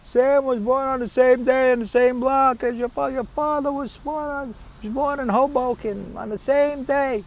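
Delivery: very high-pitched, piercing aunt impression; nagging aunt. Aunt repeating the family story to her nephew, high-pitched imitation. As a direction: screech it out high like an aunt who keeps repeating it. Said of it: "had to listen to my aunt say".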